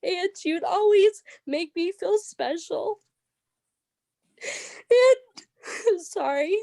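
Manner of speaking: high-pitched, real tearful voice; trying not to cry. Real, not acted. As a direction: push the words out through crying. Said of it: "I was trying not to cry".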